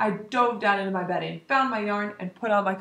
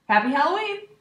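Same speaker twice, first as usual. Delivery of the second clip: cheerful greeting; 7 semitones higher, 5 dB louder than usual. Acted. Clown greets class.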